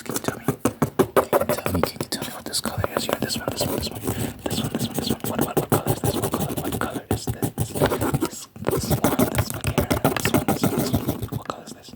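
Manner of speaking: rapid repeated ASMR whisper. Pretend. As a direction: whisper it like an eye examiner in an ASMR video. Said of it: "I'm whispering because it's an A S M R video".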